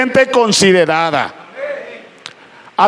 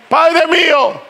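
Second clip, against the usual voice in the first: call from afar; 8 semitones higher, slower than usual. Retold or imitated. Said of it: "Así le gritaba a lo lejos".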